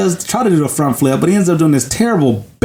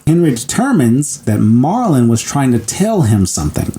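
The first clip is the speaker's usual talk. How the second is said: ordinary talk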